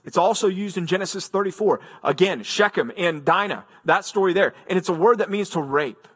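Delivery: ordinary talk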